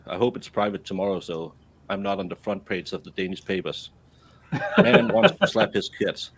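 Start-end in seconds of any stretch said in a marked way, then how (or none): 4.8-6.3 mock headline voice.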